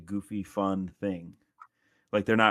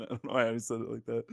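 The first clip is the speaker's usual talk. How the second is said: normal talking voice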